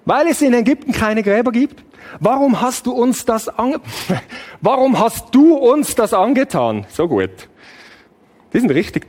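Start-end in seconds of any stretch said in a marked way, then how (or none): 0.0-6.8 high, mock-hurt complaining tone; ironic. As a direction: say it with heavy irony. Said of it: "wenn du ironisch wärst".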